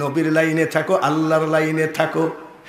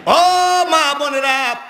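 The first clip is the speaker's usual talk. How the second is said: calling out; 10 semitones higher, 4 dB louder than usual. Real, not acted. Preacher addresses mothers and sisters, calling out high. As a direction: hail a far-off crowd. Calls out to women in congregation.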